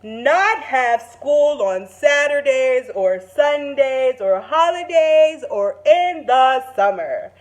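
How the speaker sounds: animated read-aloud to a class; excited story reading. Acted. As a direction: read the big moment with rising excitement. Teacher reads story climax aloud.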